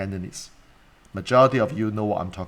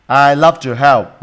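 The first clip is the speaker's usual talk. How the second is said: emphatic declaration; 3 semitones higher, 11 dB louder, slower than usual. Acted. Dramatic quote of excuse nine.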